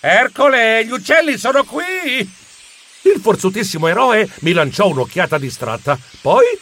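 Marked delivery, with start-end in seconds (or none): 0.0-2.2 alarmed cry. Acted. Geronimo warning Ercole the birds are here, alarmed cry. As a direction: call out in alarm.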